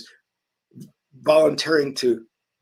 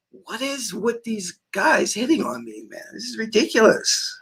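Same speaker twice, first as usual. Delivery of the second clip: mock exasperated; 7 semitones higher, 4 dB quieter than usual. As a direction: grumble in disbelief under the breath. His own retold inner thought.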